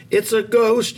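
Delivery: loud, high terrified yell; panicked high cry; frightened yell. A narrator's reading. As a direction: shout it in panic at the sight. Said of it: "yelled the disciples".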